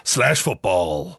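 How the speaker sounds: very slow, low American announcer; American accent. Pretend. Host names the sponsor, mock American accent. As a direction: stretch the brand out, American promo style. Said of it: "in an American accent".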